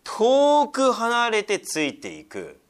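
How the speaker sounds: 遠く drawn out high and loud, very slow; slow emphatic repeat. Shown as a demonstration. Preacher stressing how far Peter followed, slow and high. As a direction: stretch 遠く離れてついていく to stress the distance. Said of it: "もうここで危険地域に入りました".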